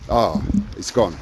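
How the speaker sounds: ordinary talk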